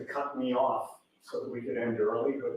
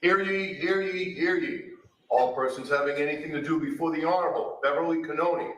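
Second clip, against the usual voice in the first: formal court crier call, slightly raised; stern archaic proclamation; 4 semitones higher than usual. Acted. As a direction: ring out the old court opening. Court officer opens session.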